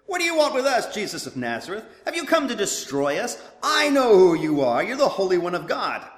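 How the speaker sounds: high, loud demon cry; possessed man shouting. A narrator's reading. Preacher reading the demon's words to the congregation, loud and high. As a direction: shout it like an evil spirit caught out. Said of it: "cried out at the top of his voice".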